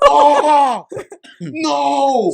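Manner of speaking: loud, high "oh no" of dismay; dismayed cry. Retold or imitated. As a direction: cry out in sudden disappointment. Song turns out to be Clinton.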